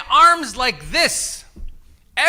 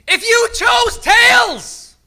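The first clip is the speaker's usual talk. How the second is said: much higher, louder, slow call; 10 semitones higher, 5 dB louder, slower than usual. Real, not acted. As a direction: announce it big to the whole room. Host calling out to crowd.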